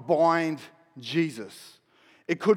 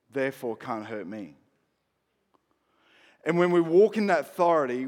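ordinary talk, nothing marked

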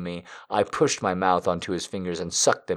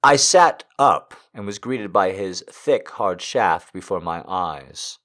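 usual speaking voice

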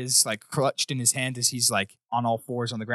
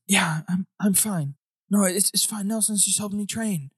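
put-on higher, timid boy's voice insisting all is fine; the beaten boy brushing it off, a little higher; nervous boy; 7 semitones higher than usual. Boy covering for Nelson to the ranger, nervous and high. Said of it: "He goes".

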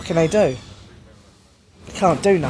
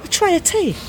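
high, scandalised squeal; outraged disbelief; 14 semitones higher than usual. Retold or imitated. Prisoner seeing queue jumper's tray, high outraged squeal.

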